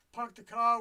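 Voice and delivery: light Boston accent demo. Shown as a demonstration. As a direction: say "Park the car" the Boston way, pahk the cah. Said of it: "would be the way you would say it in Brooklyn".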